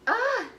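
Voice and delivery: high, loud burst of joy; overwhelmed squeal. Real, not acted. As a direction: let out a sudden excited "ah". Overwhelmed by excitement about the baby.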